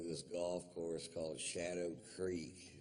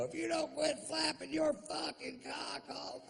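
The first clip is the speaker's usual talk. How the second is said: shout. Retold or imitated. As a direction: scream it so the whole theater hears. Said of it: "seventeen hundred people hear me go".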